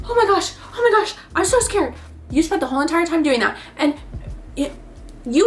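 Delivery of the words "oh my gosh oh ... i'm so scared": high, scared squeal without real volume; mock screaming. Visitor screaming and ducking in the haunted house, mock screaming. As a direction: squeal it like a play-acted fright. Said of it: "screaming and ducking".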